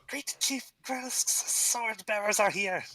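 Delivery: high, slow goblin voice; squeaky goblin gossiping to its kin; goblin chatter. Acted. Goblins whispering among themselves, high and chittery.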